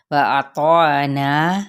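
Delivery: وأطعنا very slow, with a long na at the end; slow tajweed demo. Shown as a demonstration. Teacher models وأطعنا slowly, long final na. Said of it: "مد اصلی بالالف".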